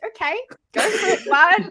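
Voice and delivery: high, rising cheer into loud bud; surprised go-ahead; mock eager. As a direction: egg him on. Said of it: "I was like".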